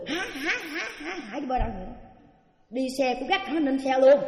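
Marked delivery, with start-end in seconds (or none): 0.1-1.9 imitating hearty laughter, higher; mock laughter; laughing. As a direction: laugh it out like the nun did. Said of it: "cô cười".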